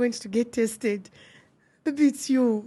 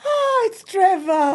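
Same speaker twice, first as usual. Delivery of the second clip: laugh-crying reveal; 6 semitones higher, 3 dB louder, slower than usual. Real, not acted.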